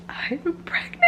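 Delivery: high, hushed squeal into a laugh; tiny excited squeal, breaking into laughter; real giddy disbelief. Real, not acted. Overwhelmed by own pregnancy news.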